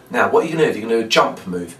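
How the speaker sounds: usual speaking voice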